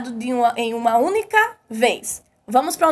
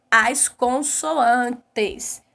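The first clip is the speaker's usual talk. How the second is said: "consoantes" very slowly, broken into beats; syllable by syllable; much slower than usual. Shown as a demonstration. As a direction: draw out "As consoantes" one syllable at a time. Teacher spells out the answer.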